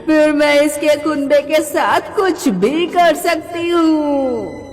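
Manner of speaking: slow, menacing evil-spirit voice; gloating witch. Acted. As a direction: draw out each word with wicked relish. Witch lays out her evil plan.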